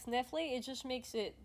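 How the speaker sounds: usual speaking voice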